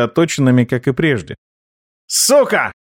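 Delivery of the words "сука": higher, startled curse; frightened cry. A narrator's reading. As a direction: yelp it in sudden pain. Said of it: "Испуганно крикнул Локи".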